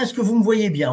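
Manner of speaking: ordinary delivery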